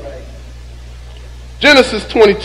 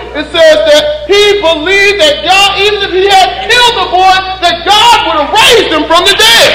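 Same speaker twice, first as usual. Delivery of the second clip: higher and louder preaching, building to shouts; preaching at full force; 9 semitones higher, 3 dB louder than usual. Acted. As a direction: drive the point home like a revival preacher.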